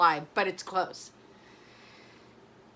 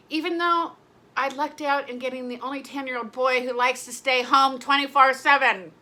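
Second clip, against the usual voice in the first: loud, raised-voice venting; yelling; 3 semitones higher, 6 dB louder than usual. Real, not acted. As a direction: get louder as you go, building to a yell. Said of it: "I don't know why I'm yelling".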